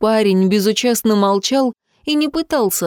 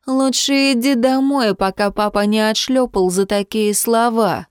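usual speaking voice

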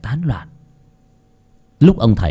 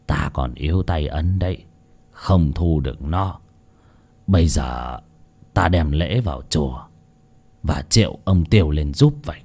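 put-on old shaman voice; 6 semitones lower, 6 dB quieter than usual. Old shaman speaks sadly.

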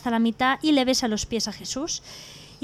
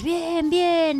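drawn-out, higher mock cheer; cheering crowd; 5 semitones higher than usual. The animals answer the king's party news, cheering.

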